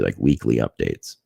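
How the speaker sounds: ordinary delivery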